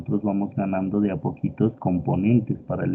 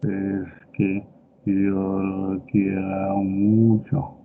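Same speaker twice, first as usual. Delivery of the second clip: put-on slow slurred drunk voice, mouth half open; drunk slurring; much slower than usual. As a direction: drag the words like a sleepy drunk. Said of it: "el borrachito le dice".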